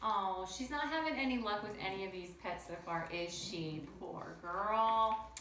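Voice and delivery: ordinary delivery, nothing marked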